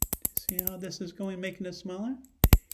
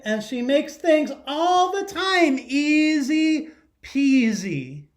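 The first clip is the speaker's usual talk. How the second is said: louder and higher, bright read-aloud voice for the class; storybook read-aloud; 4 semitones higher, 9 dB louder than usual. Acted. Teacher reading a picture book to her class, lively read-aloud. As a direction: read it big so the whole class hears.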